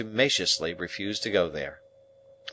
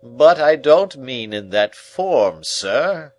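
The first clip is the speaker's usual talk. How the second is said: loud, higher, slow objection; pompous uncle; 6 semitones higher, 10 dB louder, slower than usual. Acted. Uncle objecting to being interrupted, loud and slow. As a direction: brush off the interruption grandly. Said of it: "objection to being interrupted".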